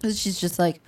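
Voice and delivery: ordinary talk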